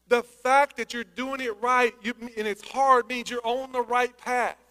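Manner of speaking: slow, every word weighed; slow emphatic repeat. Shown as a demonstration. Pastor slows down to stress the point. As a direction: drive it home slowly to the church. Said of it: "I'm gonna say it again".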